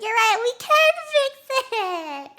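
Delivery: high, loud, laughing sing-out; hammed-up gleeful agreement; acted mock agreement. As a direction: agree over-eagerly, stretching the end. Acts Jimmy mockingly agreeing, laughing.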